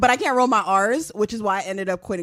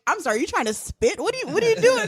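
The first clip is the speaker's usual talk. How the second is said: higher, laughing mock confusion; puzzled listener voice; 9 semitones higher, faster than usual. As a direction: act baffled, laughing. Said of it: "it's just like".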